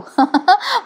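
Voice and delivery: a quick high amused laugh; short laugh. Real, not acted. Vlogger laughing at Bayo getting scolded, short real laugh.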